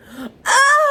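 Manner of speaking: sharp high shocked gasp; loud gasp. Real, not acted. Reader gasping at the book's ending, shocked.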